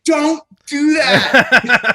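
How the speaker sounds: half-laughing shout of a retold phone rant; laughing mock yell. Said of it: "I yell at him on the phone".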